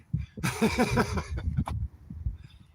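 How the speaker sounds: high, breaking out laughing; amused laugh. Real, not acted. Man amused by his mock pity, laughing. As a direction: laugh at your own joke.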